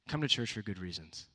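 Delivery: ordinary delivery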